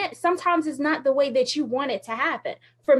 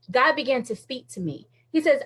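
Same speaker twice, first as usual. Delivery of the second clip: ordinary talk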